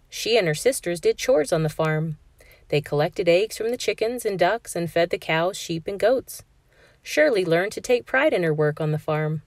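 ordinary delivery, nothing marked

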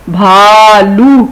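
slow, drawn-out بھالو with a breathy bh; aspirated bh. Shown as a demonstration. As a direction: stretch بھالو and push air on the bh. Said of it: "بھاری بے". (بھالو کو آہستہ، بھ پر سانس کے ساتھ)

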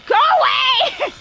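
high shrieking laugh-yell; laughing scream. Real, not acted. Gamer shrieking at the chasing killer. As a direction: shriek it while laughing in panic.

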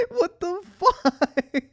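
loud, high, drawn-out disbelief; mock outrage. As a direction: rant at the screen in hammed-up shock. Outraged at a one-dollar reward.

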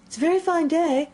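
high, timid little voice; meek, high-voiced pleasantry. A narrator's reading. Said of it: "said a timid voice".

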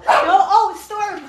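usual speaking voice

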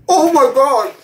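high-pitched shock from the burn; high, real burning-mouth outcry; real pained exclamation. Real, not acted. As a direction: cry out as the spice hits. Reacting to burning hot chip.

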